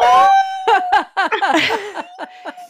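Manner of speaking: high bursting laugh; loud laughter. Real, not acted. Laughs after reading the note.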